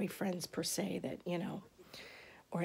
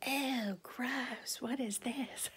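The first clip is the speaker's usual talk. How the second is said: high, mock-disgusted little girl squeal; grossed-out kid; 6 semitones higher, much slower than usual. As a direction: act out a nine-year-old grossed out by the pictures. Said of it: "having a scream fest".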